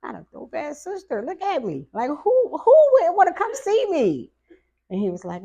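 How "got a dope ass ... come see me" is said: much higher and louder mock boast; playful bragging. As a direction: brag about being the best sister. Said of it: "And I was like".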